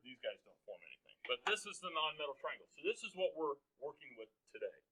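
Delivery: normal talking voice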